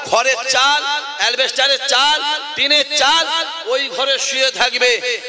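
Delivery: full-voiced preacher working up the crowd; fiery preaching. Real, not acted. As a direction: ring each phrase out to rouse the congregation.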